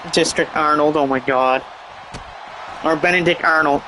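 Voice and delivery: normal talking voice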